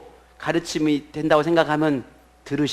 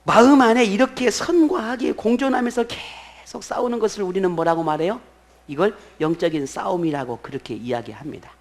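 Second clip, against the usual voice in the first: ordinary talk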